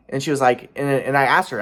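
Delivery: usual speaking voice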